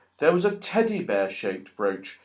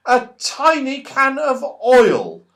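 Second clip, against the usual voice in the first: surprise reveal; 9 semitones higher, 5 dB louder than usual. A narrator's reading.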